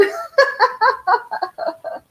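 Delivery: high laugh. Real, not acted. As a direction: laugh hard at the memory. Laughing at own story.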